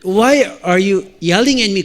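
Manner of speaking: very slow, mock child's protest in English; lightly acted affronted toddler; indignant little son. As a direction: drag out a small boy's complaint. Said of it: "영어로, 영어로 물론".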